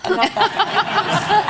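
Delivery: real laughter; laughing. Real, not acted. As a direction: laugh your denial. Laughing denial.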